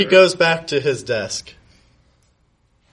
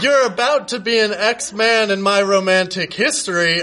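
yelling; 6 semitones higher than usual. Acted. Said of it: "I yelled".

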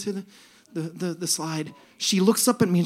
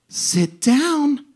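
higher, louder cheery instruction; joyful angel; 7 semitones higher, 7 dB louder than usual. Retold or imitated. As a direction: sing it out sweetly to the next rider. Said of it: "And she says".

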